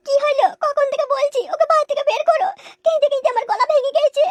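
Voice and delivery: nagging about crying herself hoarse; complaining wife. Acted. (উঁচু গলায় নালিশ)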